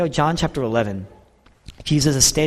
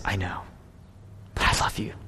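soft confession; 9 semitones lower, 11 dB quieter than usual. Acted. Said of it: "Peter says".

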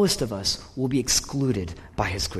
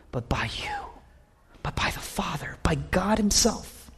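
breathy whisper of abandonment; hushed, forsaken whisper; whispered lament; 5 semitones higher, 4 dB quieter than usual. Acted. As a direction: whisper it as if broken on the cross. Voicing Jesus forsaken by God.

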